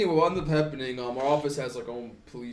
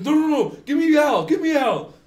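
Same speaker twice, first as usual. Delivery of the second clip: high, loud, urgent demand; frantic sister; 13 semitones higher, 9 dB louder than usual. Retold or imitated. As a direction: imitate a panicked sister shouting down the phone.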